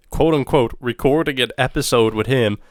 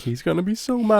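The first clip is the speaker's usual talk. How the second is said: higher, worried whine; high mock-worried line; retold dread; 9 semitones higher than usual. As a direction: act out the sinking feeling. Retelling his own dread.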